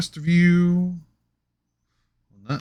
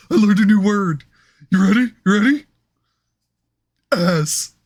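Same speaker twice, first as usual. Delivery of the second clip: Patrick Star voice; 7 semitones higher, 5 dB louder than usual. Pretend.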